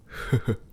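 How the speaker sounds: short high breathy chuckle; a quick pleased laugh under the breath; amused chuckle. Acted. Demon master amused by the captive human, short chuckle.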